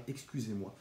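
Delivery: ordinary delivery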